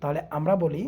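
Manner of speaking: ordinary talk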